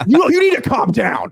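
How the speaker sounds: high, shouted calm down; mock yell. Host mock-shouting at someone who told him to calm down. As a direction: yell it back in mock outrage.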